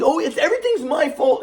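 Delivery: high mocking self-pitying voice; sarcastic grandpa. Grandpa faking blame to make grandson the bad guy, sarcastic. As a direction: play the martyr with a sneer. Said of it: "He'd be sarcastic".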